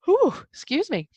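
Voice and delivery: words squeezed out through a yawn; yawning apology to listeners. Real, not acted. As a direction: yawn out a breathy sigh. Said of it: "I'm clearing this for you people too".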